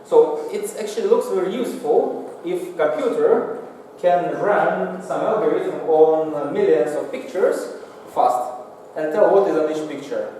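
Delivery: ordinary delivery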